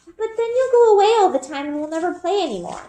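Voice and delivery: put-on high little girl voice, sulking; a small child pouting that her sister will leave; little sister Eliza. A narrator's reading. Little sister fearing Bryn will go away, high sulky child voice.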